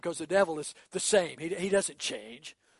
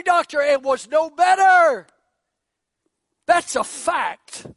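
loud, high preaching build; 7 semitones higher, 9 dB louder than usual. Acted. Preacher telling the congregation of the bleeding woman, full force. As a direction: drive the story home, loud and high.

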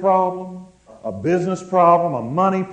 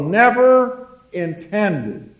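slow, deliberate words; slow and deliberate emphasis; said real slow. Real, not acted. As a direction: drag each word out for weight. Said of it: "I'm gonna say this real slow".

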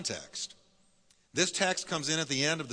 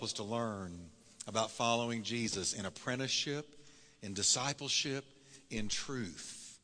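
soft, lower, hushed prayer; 3 semitones lower, 6 dB quieter than usual. Acted. Pastor praying before the sermon, softly and lower. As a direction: pray it quietly over the congregation.

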